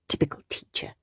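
sulky whisper. Acted. Henry grumbling to himself about the teacher, whispered. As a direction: mutter it under the breath.

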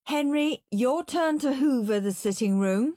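a lightly voiced brisk mum; Mum's voice. A narrator's reading. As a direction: call him to his chores. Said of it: "said Mum".

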